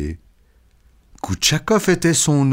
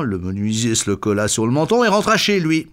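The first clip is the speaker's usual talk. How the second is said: plain reading voice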